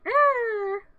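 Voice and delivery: high, loud imitated puppet whine; creature whine. Hurt pet in the bathroom, whining high. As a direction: squeal like a hurt little creature.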